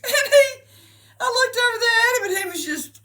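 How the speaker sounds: very high, loud, squealed words through laughing; helpless laughter. Real, not acted. Mother recalling her son after the film, cracking up. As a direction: struggle to speak for laughing.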